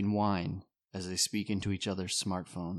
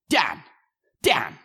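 shouting; 6 semitones higher, 10 dB louder than usual. Acted. Rich calling for attention.

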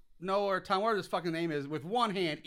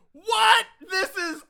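much higher, louder mock amazement; amazed reaction; 8 semitones higher, 11 dB louder than usual. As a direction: gush about a wild ride. Retelling his reaction to film.

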